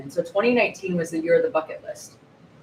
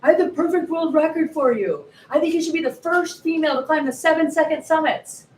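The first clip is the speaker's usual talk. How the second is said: excited coach; 10 semitones higher, 4 dB louder than usual. Retold or imitated. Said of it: "he's all excited".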